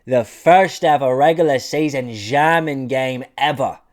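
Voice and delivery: loud, slow, hammed-up German accent; big announcement in a fake German accent; mock German accent. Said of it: "I can't do a German accent".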